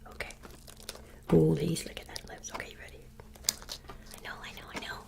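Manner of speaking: hushed whisper coaxing the dog; soft whisper to a shy pet. Real, not acted. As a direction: whisper so the mic catches the dog.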